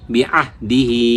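short বি and দি, long final হি; joined recitation. Shown as a demonstration. Teacher modelling the word for students, বি আহ দি হি with stretched ending. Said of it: "আমরা উচ্চারণ কি করব".

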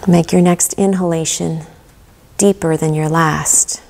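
calm guided meditation with long pauses. Acted. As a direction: guide them gently into relaxation, leaving room to breathe.

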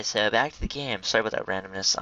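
ordinary talk

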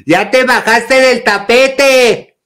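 high, drawn-out scolding shout; loud, slow, high-pitched yell; retold yelling. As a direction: holler at your brother, stretching the words. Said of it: "Así con unos gritos yo".

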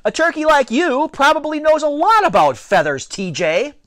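high, loud retort; shouting back. A narrator's reading. Alex answering T J's taunt, shouted back. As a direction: yell the comeback at a high pitch. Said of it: "I shouted back".

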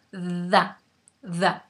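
"The" with the tongue between the teeth, buzzing a little; voiced th. Shown as a demonstration. Teacher showing students the buzzing th. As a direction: let "the" vibrate on the tongue. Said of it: "Les vibra un poquito".

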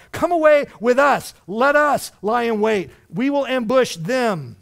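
high, loud, emphatic summons; loud rallying call. Acted. Preacher voicing tempters luring recruits, loudly calling out. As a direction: call out forcefully, leaning on each phrase.

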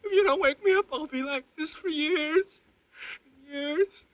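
high, sobbing terror; nightmare moaning. Acted. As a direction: wail for help, crying and desperate.

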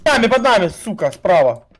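loud warning call, high and sharp; urgent shout. Real, not acted. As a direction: yell the enemy's position. Enemy spotted, warning team.

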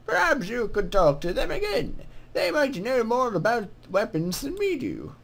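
put-on lilting greeting from an old-world scribe; put-on scribe voice, swooping in pitch; scribe's character voice. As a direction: voice a game scribe saying hello. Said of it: "what voice should I give him".

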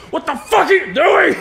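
angry dad. Retold or imitated. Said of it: "he literally just went".

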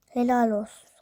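mock "Helal olsun" said out loud, with stress on "helal"; sarcastic praise. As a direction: call out "Helal olsun" as a taunt.

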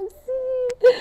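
very high, drawn-out squeal; super happy. Retold or imitated. Climber celebrating the summit with her sherpa, delighted squeal. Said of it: "él estaba como súper feliz".

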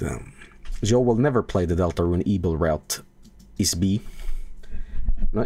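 usual speaking voice

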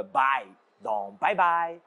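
« bye » dit lentement, comme en anglais; bye à l'anglaise. Shown as a demonstration. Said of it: "Bai se prononce comme". (bye lentement, à l'anglaise)

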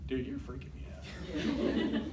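a student whispering that the teacher is too close; whispered unease. Retold or imitated. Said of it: "sitting there going".

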